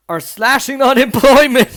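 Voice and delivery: reading the headline through laughter; laughing. Real, not acted. Vlogger reading a news headline aloud, laughing in disbelief.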